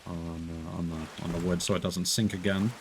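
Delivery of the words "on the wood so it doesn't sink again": usual speaking voice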